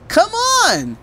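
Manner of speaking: high, loud urging; eager call. Acted. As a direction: hurry daddy along. Trixie urging daddy along.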